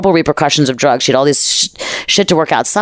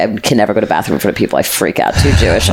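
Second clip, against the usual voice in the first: usual speaking voice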